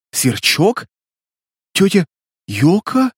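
hesitant high-pitched question with pauses; stunned recognition, word by word; fallen, stunned voice. A narrator's reading. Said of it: "упавшим голосом произнесла Настя". (упавшим голосом, растерянно)

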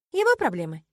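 loud, curt brush-off; cutting dismissal. A narrator's reading. Heroine dismissing her roommate's reminder, curtly. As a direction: shut the subject down abruptly. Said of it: "отрезала я".

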